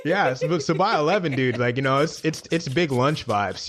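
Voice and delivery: playing a wheatgrass-sipping LA brunch type; mock wellness LA accent. Friend teasing LA brunch crowd in a put-on LA accent. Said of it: "I can't even do this fucking accent".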